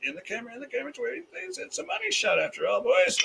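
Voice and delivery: liquored up. Real, not acted. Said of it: "I'm kinda liquored up 'cause I'm four shots in".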